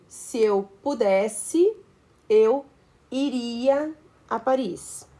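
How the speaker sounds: slow, deliberate reading of the correct sentence; slow example sentence. Shown as a demonstration. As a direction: read slowly, word by word, as the correct model. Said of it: "por exemplo".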